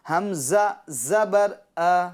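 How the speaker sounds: slow, measured syllable-by-syllable recitation; spelling drill. Shown as a demonstration. Teacher leading children to repeat, slow spelling drill. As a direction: sound it out slowly for repeating.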